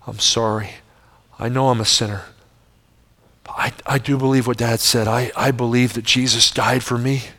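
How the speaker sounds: a hint of a child praying softly at his bedside; hushed child's prayer. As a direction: pray it quietly like a small boy. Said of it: "the gist of my prayer".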